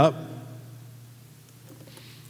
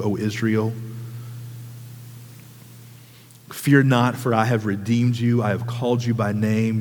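lower, softer, unhurried reading of Isaiah; a quiet, tender reading of God's words; scripture reading; 7 semitones lower, 4 dB quieter than usual. Acted. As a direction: read the promise gently to the congregation. Reads Isaiah forty-three aloud.

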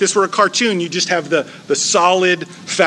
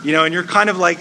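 normal talking voice

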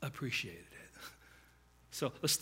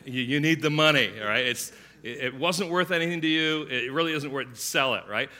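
loud, pushy mock plea to just sell it; mock urging; 8 dB louder than usual. Preacher mimicking couple urging guest to sell, loud and pushy. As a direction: egg him on, half joking.

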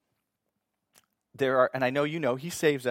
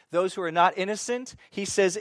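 louder, higher, building intensity; rising preaching fervor; 5 semitones higher, 5 dB louder than usual. Acted. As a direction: preach it like the verdict is coming.

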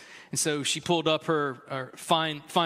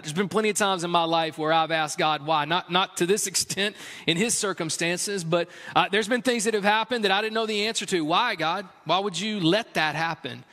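higher, louder impassioned preaching voice; 4 semitones higher, 4 dB louder than usual. Acted. Pastor rallying his congregation, higher and louder preaching. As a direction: press the congregation with rising fervor.

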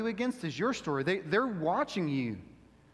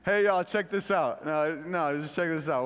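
showing off; 2 semitones lower than usual. Pretend. Showing off a party trick.

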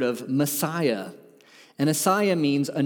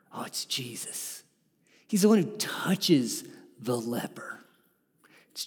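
hushed whisper; 2 semitones lower, 5 dB quieter than usual. Real, not acted. Preacher answers his own question, reverent whisper. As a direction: breathe the name in a whisper.

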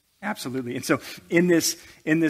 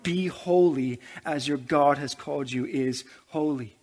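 usual speaking voice

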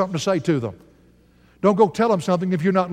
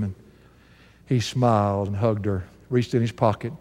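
normal talking voice

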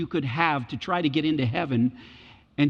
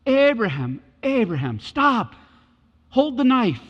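calling out; 5 semitones higher, 4 dB louder, slower than usual. Retold or imitated. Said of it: "God calls out of the heavens".

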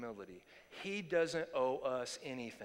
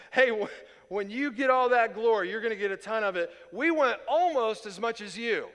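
loud, higher mock disciples' demand; brash request; 5 semitones higher, 9 dB louder than usual. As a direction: pitch it up like a pushy favour-seeker. Mimics disciples' brash request for glory.

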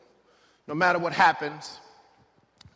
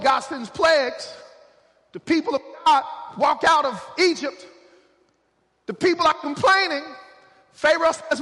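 high, driving sermon delivery; impassioned pulpit storytelling, pushed up high; full-force preaching; 9 semitones higher, 5 dB louder than usual. Real, not acted.

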